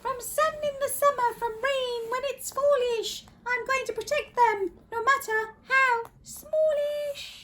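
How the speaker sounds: very high little voice; young kangaroo. Acted. Young kangaroo promising protection, high little voice. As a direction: pipe it in a tiny high voice. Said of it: "the young kangaroo in her pouch".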